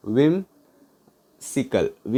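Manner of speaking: whimsical very slow, a long gap after whim; broken in two. Shown as a demonstration. Teacher helping learners remember whimsical, split with a long pause. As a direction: say whim, pause, then sical.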